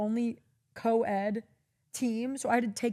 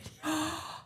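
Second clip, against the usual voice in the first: gasp; 7 semitones higher, 6 dB quieter than usual. Real, not acted. Shocked at the retold twist.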